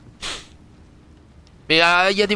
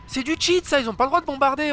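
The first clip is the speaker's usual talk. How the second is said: indignant protest; 8 semitones higher than usual. Real, not acted. Outraged at game bombing.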